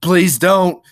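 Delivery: loud, high panicky plea; begging. Acted. Lenny in a panic over her screams, begging. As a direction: beg her urgently to stop. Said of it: "he begged".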